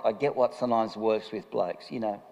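ordinary talk, nothing marked

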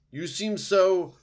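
high, loud, drawn-out disgust; owl screech. A narrator's reading. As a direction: stretch out the screeched accusation. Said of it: "screeched the owl from the very top".

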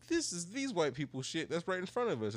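high-pitched, indignant retelling; retold indignation. Retold complaint about trash on table.

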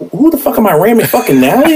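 high-pitched, comic indignant outburst; mock exasperation. Husband retelling his reaction to a geography question, mock exasperated. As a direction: act out being baffled and fed up, voice pushed high.

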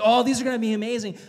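fast, eager delight; excited. Retold or imitated. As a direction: gush it quickly. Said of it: "He's like".

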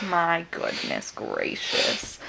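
slow exasperated whisper; whispered exasperation. Real, not acted. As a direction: sigh it out under your breath. Exasperated aside.

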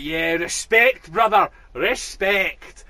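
slow, drawn-out respect; mock Glaswegian, slow and loud. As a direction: stretch every word like a solemn blessing. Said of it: "He's like".

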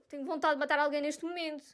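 dropped suddenly to a flat soft murmur; deadpan mutter. Real, not acted. After her outburst, a sudden quiet aside. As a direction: say it under your breath, deadpan.

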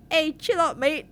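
high, loud attempt at an Australian accent; bad Australian accent. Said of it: "a troll with an Australian accent".

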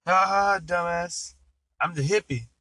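high-pitched taunting reveal; mock gloating. Hippie unmasking himself to the nun, gloating. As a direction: crow it like a prankster who got away with it.

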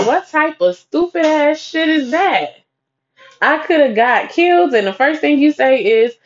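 high, exasperated retold outrage; indignant disbelief. Niece fuming at those who left her at club, indignant.

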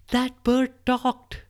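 high, slow frightened cry; terrified. Acted. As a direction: gasp it out in terror. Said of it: "screamed out of terror now".

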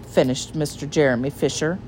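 usual speaking voice

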